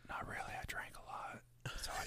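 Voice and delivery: whispered joking aside; whispering. Real, not acted. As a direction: whisper it, then laugh. Jokey aside about drinking.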